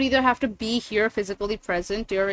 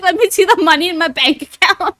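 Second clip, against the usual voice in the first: higher, louder mock voice of her demand; teasing impression of a friend demanding cash; mocking imitation; 8 semitones higher, 10 dB louder than usual. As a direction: parrot her greedy line back with a laugh. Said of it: "She's like".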